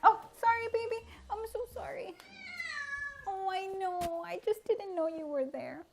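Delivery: high cooing apology. Real, not acted. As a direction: coo sorry to a cat you stepped on. Apologising to pet cat.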